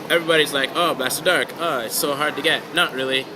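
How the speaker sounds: ordinary talk, nothing marked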